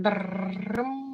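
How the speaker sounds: trilled mock drumroll; imitated drumroll. Artist unveiling a painting to co-host, imitated drumroll. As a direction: roll your lips like a drumroll.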